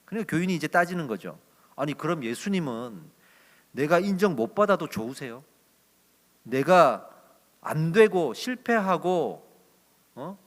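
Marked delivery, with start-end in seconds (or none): 1.7-10.4 louder, heated protest from a church member; the member blurts it out, stung and indignant; flaring up at Jesus. Retold or imitated. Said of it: "이렇게 이제 욱해서 말을 한 거예요". (욱해서 따지는 교인처럼 크게)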